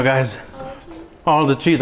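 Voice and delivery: usual speaking voice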